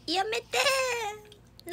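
high, slow stretched-out protest; high, long playful cry to the dog; drawn-out cry. Real, not acted. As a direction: wail a long stop it at the dog. Reacting to the dog.

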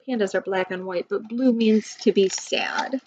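plain reading voice

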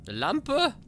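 high, loud disbelieving squawk; outraged disbelief at finding only a lamp; incredulous. Real, not acted. Enttäuscht über den Fund. (ungläubig, hoch und laut)

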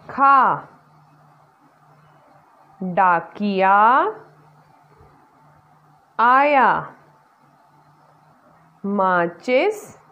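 each word spaced out for the class to echo; word by word for repeating. Shown as a demonstration. Teacher reads sentences word by word for the class to repeat. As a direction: pause after every word so children can repeat. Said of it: "आप सभी मेरे साथ दोहराइए".

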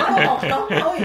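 loud laughing protest. Real, not acted. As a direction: laugh while insisting. Laughing argument over count.

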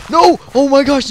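sudden high shout of panic; alarmed yell. Real, not acted. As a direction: cry out as you get hit. Panicked game moment.

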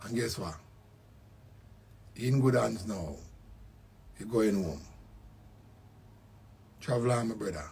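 hushed, moved send-off; emotional farewell. Real, not acted. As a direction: say goodbye to a dear friend gently. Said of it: "that man me get emotional".

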